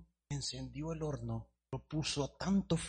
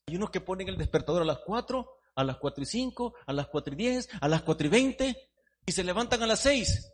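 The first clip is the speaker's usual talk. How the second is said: preaching, rising to a shout; fiery preaching; 6 semitones higher, 8 dB louder than usual. Acted. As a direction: build it up, louder each time. Preacher building to climax.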